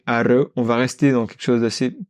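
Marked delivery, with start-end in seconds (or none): 0.1-0.5 "arre" with a trilled r; rolled r. Shown as a demonstration. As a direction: roll the r in "arre". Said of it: "la technique du bébé".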